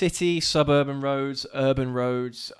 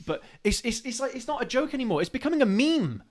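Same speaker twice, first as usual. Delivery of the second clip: exasperated rant; 10 semitones higher than usual. Real, not acted. Heated rant about speed limits.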